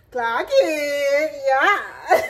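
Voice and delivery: high, crying-laughing clock it; high laughing brag; laughing hype. Real, not acted. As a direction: laugh through it with pride.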